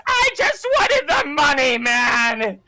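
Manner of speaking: high, loud panicked squeal; whining goblin. Acted. Goblin running from the T-Rex, high panicked wail. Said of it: "the goblin goes".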